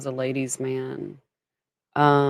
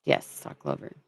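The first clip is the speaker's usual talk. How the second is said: whisper; 6 dB quieter than usual. Real, not acted. Whispered aside.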